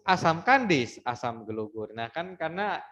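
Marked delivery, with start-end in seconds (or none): 0.1-1.9 sing-song up on "kandis", down on "gelugur"; rising then falling tune. Shown as a demonstration. Teacher showing the pantun melody rising and falling. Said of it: "nadanya tuh kan begitu".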